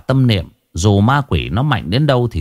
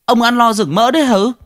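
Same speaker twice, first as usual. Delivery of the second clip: choked with indignation; 13 semitones higher than usual. Acted. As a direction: lash out at him, barely holding back. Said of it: "uất nghẹn".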